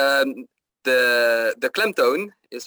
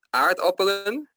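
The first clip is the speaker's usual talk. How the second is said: "aardappelen" with the stress on AARD, pitched high; correct stress; 7 semitones higher than usual. Shown as a demonstration. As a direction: demonstrate AARD-appelen with a high, rising tune. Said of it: "Dus dat is de klemtoon".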